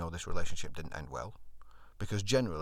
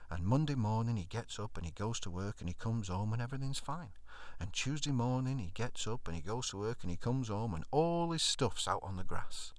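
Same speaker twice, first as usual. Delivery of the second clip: normal talking voice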